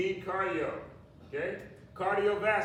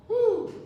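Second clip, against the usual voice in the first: excited whoop; 7 dB louder than usual. Real, not acted. Instructor cheering class on.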